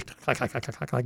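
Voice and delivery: soft clipped clack clack imitating old typewriters. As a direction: tap out the typewriter keys quietly.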